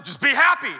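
loud, high mock command; pushy advice. Telling a depressed person what to feel, loud and pushy. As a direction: push it out forcefully, mock insensitive.

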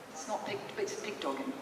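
ordinary talk

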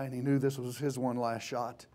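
ordinary delivery

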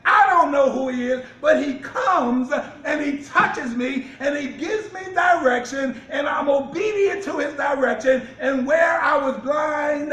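loud shouted testimony preaching. Acted. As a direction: proclaim it to the congregation. Preaching testimony of the healed man.

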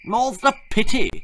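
snide high schoolboy voice; sniping. Acted. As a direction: snipe it like a spoilt brat. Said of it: "sniped Percy".

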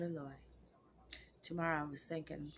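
ordinary talk, nothing marked

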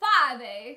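fave said as "fahveh" with a French accent; fumbling for the French vowel in fave; French accent try. Shown as a demonstration. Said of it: "how you would say it with the accent".

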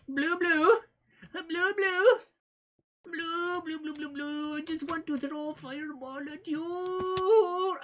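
high falsetto singsong; silly high call. Pretend. Player luring blue opponent to throw a fireball, silly falsetto. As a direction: coo it high and playful at an opponent.

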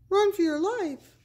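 high, frantic warning cry; panicked cry. Acted. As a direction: shout it like you're running for your life. Panicked character cries out.

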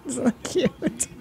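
slow, soft coo over a cute cat. Real, not acted. Reacting to a cat video, slow soft cooing.

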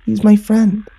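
put-on high little-girl voice; defensive little sister. A narrator's reading. Little sister vouches for her unseen friend, defensive. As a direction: pitch it up like a child.

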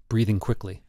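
plain reading voice

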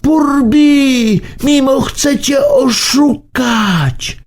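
high, slow urgent shout. A narrator's reading. Kropka shouts a warning to Burbi, high. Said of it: "teraz krzyczała kropka".

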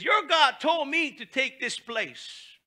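slow, high, loud boast; mock-grand claim, slow and high; boastful envoy. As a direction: declare it slowly and loud. Rabshakeh's claim voiced.

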